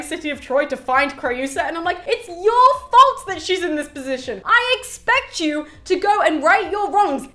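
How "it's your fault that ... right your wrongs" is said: high, loud telling-off; indignant scolding. Acted. Reader blames Aeneas for his wife's position, scolding. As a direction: scold him with exasperated force.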